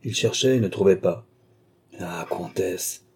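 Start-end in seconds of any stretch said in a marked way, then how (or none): 2.0-3.1 soft and slow, half to himself; a slow low murmur to the absent countess. A narrator's reading. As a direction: breathe it out like a private threat. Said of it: "murmura-t-il".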